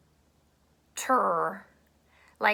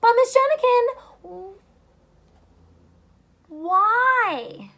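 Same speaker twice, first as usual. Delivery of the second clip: put-on high pleading kid voice; a mock child protesting cleanup; whining child; 10 semitones higher, 4 dB louder, slower than usual. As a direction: whine like a child who wants to keep playing. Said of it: "and they say".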